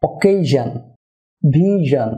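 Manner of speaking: অকেশন and ভিশন ending in a buzzing "zhan"; slow word-list demo, "zhan" endings on অকেশন, ভিশন; -sion as zhan. Shown as a demonstration. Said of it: "এসআইওএন সাফিক্সের উচ্চারণ হবে".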